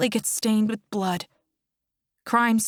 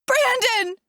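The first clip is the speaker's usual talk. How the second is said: very high, desperate scream; shrieked, distraught call; 4 dB louder than usual. A narrator's reading. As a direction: scream the name to wake him. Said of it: "I scream for him to wake up".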